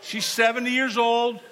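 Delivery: loud, high, laughing storytelling; excited retelling. Real, not acted.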